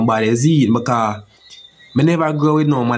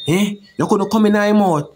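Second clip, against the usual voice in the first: higher, teasing jeer from the crowd; mocking taunt; 7 semitones higher than usual. Neighbours taunting the young man, higher mocking jeer. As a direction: needle him like the mocking neighbours. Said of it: "Them a say".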